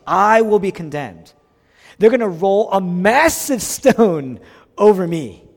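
loud, rising sermon cry; emphatic proclamation. Acted. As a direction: proclaim the coming doom at full voice. Preacher voices Jesus foretelling condemnation.

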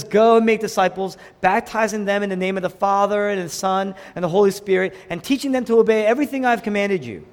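high, loud scripture recitation; proclaiming scripture. Real, not acted. Preacher quoting Jesus' commission to the church, forceful proclamation.

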